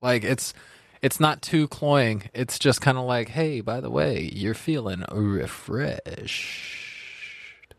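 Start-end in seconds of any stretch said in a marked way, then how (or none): 3.3-7.7 slow voiced drink. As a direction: drawl it like a sly secret. Drink personified, slow quote.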